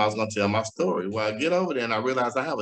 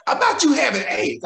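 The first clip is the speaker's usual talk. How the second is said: friend on the phone; 11 semitones higher, 4 dB louder than usual. Retold or imitated.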